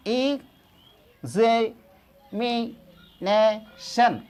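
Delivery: Examination stretched very slowly, each syllable stressed; exaggerated teacher demo; stressed slow pronunciation. Shown as a demonstration. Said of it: "तो यहाँ पर stress भी जाना है".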